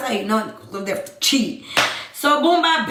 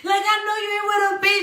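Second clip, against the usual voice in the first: high, frantic accusation; going crazy; 9 semitones higher, faster than usual. Retold or imitated. Hurt woman accusing her cheating partner, going crazy. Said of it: "So I'm going crazy".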